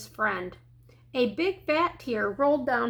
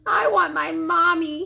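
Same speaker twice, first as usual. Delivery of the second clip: put-on higher, louder whining child; tearful duckling; 4 semitones higher, 5 dB louder than usual. A narrator's reading. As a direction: whine it like a weepy little one. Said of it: "A fat little tear rolled down his bill".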